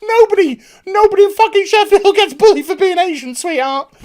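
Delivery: ranting reviewer mocking a character; mocking outburst. Real, not acted. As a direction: scoff at her loudly. Mocking retort to character's complaint.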